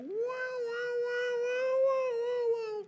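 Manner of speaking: loud, high-pitched "wow wow" wailing; stretched, siren-like mock wailing; mimicked nagging wail. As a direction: imitate an endless high nagging noise. Mimics wife's nagging outburst.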